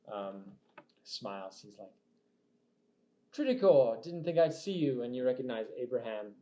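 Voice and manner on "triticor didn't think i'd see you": loud, high-pitched knight's greeting; big exclaimed hello in character; hearty knight. Acted. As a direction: hail an old comrade loudly. Game master greets player as Abraham.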